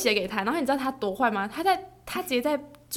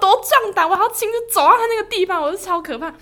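excited; 10 semitones higher, 11 dB louder than usual. Real, not acted. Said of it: "excited retelling".

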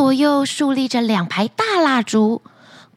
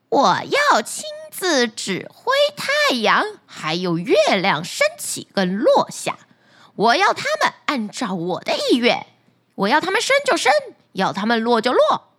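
power-mad wife. Acted. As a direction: lay down the law grandly. Wife declares her wish.

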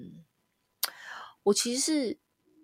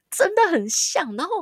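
laughing it out, high and loud; 13 semitones higher, 6 dB louder than usual. Real, not acted. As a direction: laugh through the words, slow. Laughing at own eerie experience.

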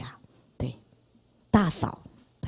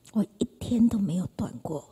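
whispered, almost no voice; ashamed whisper; hushed confession; 3 semitones lower, 10 dB quieter than usual. Real, not acted. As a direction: breathe it out like a secret. Confessing daily drinking, hushed.

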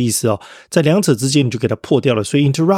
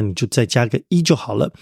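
ordinary talk

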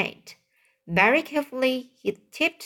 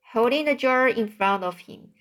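ordinary delivery

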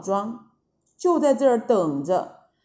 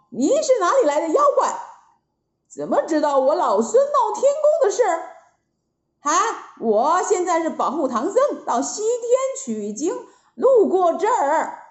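brisk Monkey King; 7 semitones higher, faster than usual. A narrator's reading. Wukong questions the monster.

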